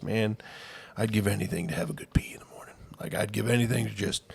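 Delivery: put-on hushed old guy. Old man in joke complaining.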